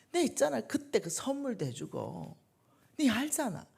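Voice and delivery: high, whiny complaint about a friend's betrayal; indignant gossip. Acted. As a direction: pitch it up and protest how unfair it is. Acting out a hurt gossip complaint. (높고 억울하게 하소연하는 목소리)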